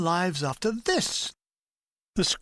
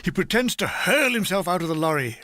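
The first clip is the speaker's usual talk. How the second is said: ordinary delivery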